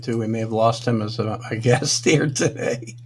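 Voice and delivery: ordinary talk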